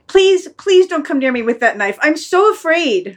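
stretched-out "so afraid"; frightened pleading. Acted. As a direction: plead like a terrified dog. Dog begs before the knife.

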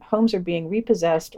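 usual speaking voice

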